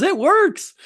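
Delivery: very high, triumphant; squeaky triumphant imitation; squeaky glee. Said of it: "He goes".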